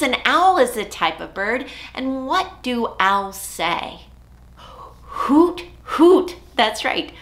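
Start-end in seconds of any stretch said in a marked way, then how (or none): 4.6-6.4 higher sung hoot; playful owl call. Acted. Imitating owl call for kids.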